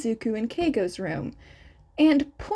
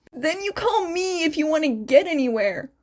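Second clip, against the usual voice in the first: exasperated Hizashi; 4 semitones higher, faster than usual. Acted. Hizashi's voice in story.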